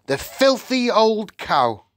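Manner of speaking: high, drawn-out outraged exclamation; angry shout. A narrator's reading. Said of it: "he shouted".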